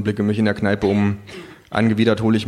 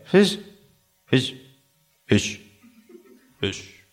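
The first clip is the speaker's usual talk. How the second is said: fading repeat; 4 semitones higher, much slower than usual. A narrator's reading. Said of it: "dann kippt es nach hinten".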